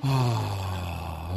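long, low exhaled sigh; long relieved sigh. Pretend. Host pretending to return refreshed from a break, relieved sigh. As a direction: let out a big contented breath.

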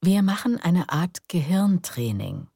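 plain reading voice

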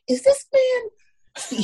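high, very slow astonished question. Retold or imitated. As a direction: draw it out in disbelief. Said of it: "I was like".

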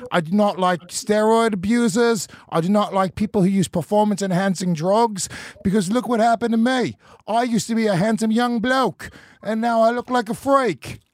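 hammed-up British parody of an aggrieved fighter; Michael Bisping. Co-host as the fighter railing against cheats, mock British impression. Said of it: "I am Michael Bisping".

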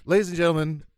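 upbeat pitchman selling merch; infomercial host voice. Pretend. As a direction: hype it up like a TV salesman.

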